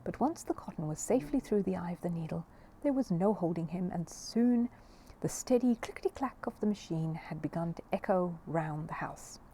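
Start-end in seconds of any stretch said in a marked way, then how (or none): none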